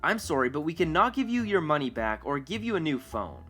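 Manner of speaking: ordinary delivery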